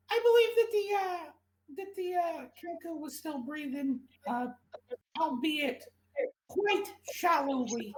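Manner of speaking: high, put-on genteel rat voice; the rat gathers itself to make a grave report; composed rat doctor. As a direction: Ratson reports the Kenku's condition in a high put-on voice. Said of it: "he gets it together and goes".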